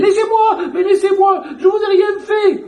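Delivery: high, loud panicked pleading; terrified screaming. Retold or imitated. Madwoman crying out at imagined attackers, screaming high and loud. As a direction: scream it like you're being dragged off. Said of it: "elle au milieu qui criait".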